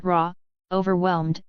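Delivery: ordinary delivery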